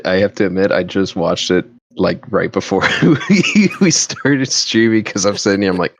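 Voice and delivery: ordinary delivery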